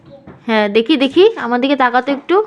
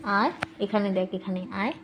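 soft luring; 7 semitones lower, 14 dB quieter than usual. Pretend. Mother to child, softly luring her to papa. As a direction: lower and much softer.

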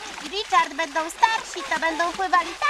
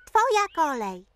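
usual speaking voice

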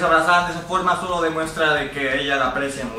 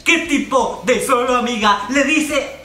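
outraged excited shout; 9 semitones higher, 3 dB louder than usual. Real, not acted. Ranting at yuri anime scene.

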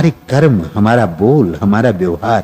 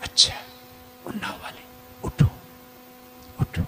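very soft, nearly breathless call; soft, hushed call; 10 semitones higher, 18 dB quieter than usual. Retold or imitated. Teacher imitates Baba softly calling the Unnao group to stand, hushed. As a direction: gently hush them to rise.

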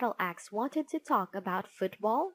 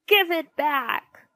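shouting; 7 semitones higher, 9 dB louder than usual. A narrator's reading. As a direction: shout for your pen back. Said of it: "shouted Inky Ian".

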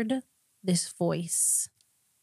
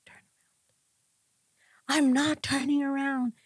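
much higher, stubborn refusal; defiant yelling; 8 semitones higher than usual. Retold or imitated. Arguing with the Holy Spirit in her car, high defiant yell. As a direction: shout back at the voice, refusing. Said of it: "I'm like".

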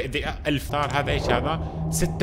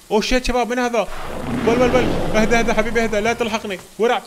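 startled panic; 6 semitones higher, 4 dB louder than usual. Real, not acted. Panics as dinosaur charges.

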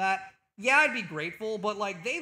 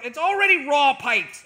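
loud, higher-pitched outburst; exasperated protest; 6 semitones higher, 12 dB louder than usual. Real, not acted. Streamer answering jab about his throat, exasperated shout.

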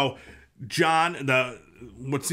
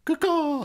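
bird call. Pretend. Mock bird call in the retelling.

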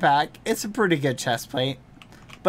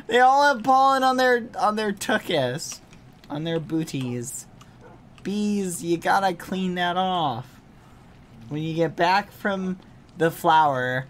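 ordinary talk

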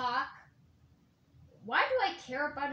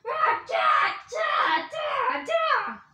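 battle cry; 11 semitones higher, 7 dB louder, much slower than usual. Pretend. Toy battle cries.

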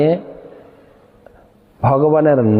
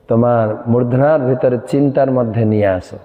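usual speaking voice

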